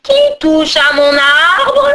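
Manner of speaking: slow, loud, drawn-out growl; growling ogress. A narrator's reading. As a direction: bellow menacingly like a child-stealing hag. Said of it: "gronda l'horrible Bouma".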